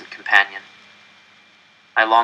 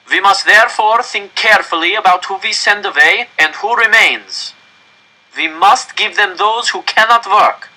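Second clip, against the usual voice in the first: director's raised, higher public address; stern official; 10 semitones higher, 6 dB louder than usual. Acted. Director warning the crowd into the microphone, raised and stern. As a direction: command the whole square from the microphone.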